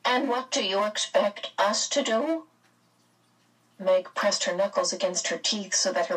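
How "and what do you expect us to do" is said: higher, cool character voice; cold. A narrator's reading. Mrs Whatsit answering Meg's appeal, coldly. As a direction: ask it coolly, without sympathy. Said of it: "Missus Whatsit's voice was cold".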